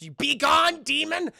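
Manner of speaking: high, forceful mock incantation; commanding spell. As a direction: proclaim it like a hammed-up exorcist.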